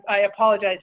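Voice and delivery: ordinary talk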